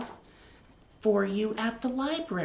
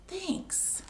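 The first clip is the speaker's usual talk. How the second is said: high, soft, timid thanks; a shy little bear thanking; small bear thanks; 9 semitones higher, 8 dB quieter than usual. Acted. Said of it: "And Bear says".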